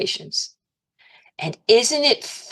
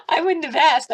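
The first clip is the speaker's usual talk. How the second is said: high, laughing through the words; amused, pitched well up; 8 semitones higher than usual. Real, not acted. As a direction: say it through giggles. Laughing at own confession.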